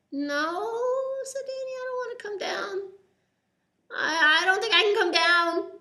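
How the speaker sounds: put-on high, tense, frightened kid voice; a boy stuck in a tree, afraid to move; scared child.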